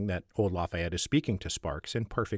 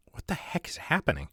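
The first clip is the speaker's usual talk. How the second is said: startled; 8 semitones higher than usual. Acted. Sparks' startled inner thought.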